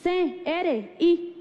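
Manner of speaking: high, deliberate dictation; spelling aloud. Retold or imitated. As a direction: spell it out slowly for a clerk. Clerk spelling the name.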